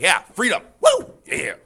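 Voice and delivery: mock patriot cheer. Teacher imitating cheering patriots for the class, mock whoop. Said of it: "That's people who are like".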